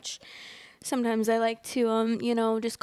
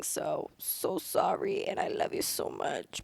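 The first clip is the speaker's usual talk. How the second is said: slow, breathy, much softer murmur; soft whispered apology; 5 semitones lower, 5 dB quieter, slower than usual. Real, not acted. Vlogger apologizing to viewers for yawning, in a slow whisper. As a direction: whisper a gentle sorry to the audience.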